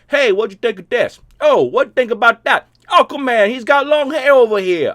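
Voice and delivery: loud, high New York accent mocking a lazy fan question; brash New York accent, playing a bored fan; New Yorker. Streamer mocks a shallow fan question in loud New York accent. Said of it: "I turned into a New Yorker".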